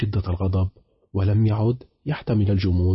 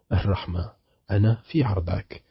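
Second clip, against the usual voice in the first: usual speaking voice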